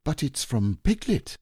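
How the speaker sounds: high exclamation of discovery; surprised cry. A narrator's reading. Boy finding who sent the message, high and startled. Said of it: "cried Christopher Robin when he had read it".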